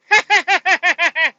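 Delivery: high, loud put-on cackle; a smug politician's braying laugh; mocking laugh. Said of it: "the other one's like".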